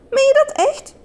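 shrieked very high, overjoyed disbelief; astonished squeal. A narrator's reading. Surprised by proposal.

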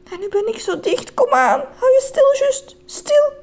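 high, strained, tearful pleading; panicky self-talk. Acted. Man dangling from torn blanket, tense high pleading. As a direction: urge yourself to keep still, high and anxious.

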